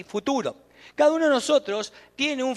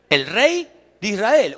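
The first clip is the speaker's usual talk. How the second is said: proclaiming the king; 6 dB louder, slower than usual. Retold or imitated. Proclaiming the crowd's acclaim.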